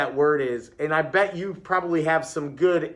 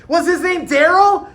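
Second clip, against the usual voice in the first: eager guessing; 13 semitones higher, 10 dB louder than usual. A narrator's reading. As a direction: shout the guess like an eager pupil. Pupil teases Daryl.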